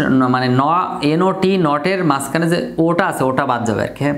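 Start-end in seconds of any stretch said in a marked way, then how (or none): none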